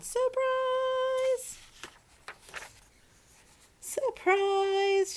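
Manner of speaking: very loud, very high joyful shout; cheerful maid shouting; shouted surprise. A narrator's reading. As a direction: yell it out to spring the surprise. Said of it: "shouted Amelia Bedelia".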